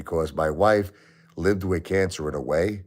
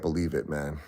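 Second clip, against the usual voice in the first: numb disbelief that fades to near silence; fading, much softer; 3 semitones lower, 8 dB quieter than usual. Real, not acted. Widower tells viewers he can't believe she's gone, fading softer. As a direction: let each repeat sink softer.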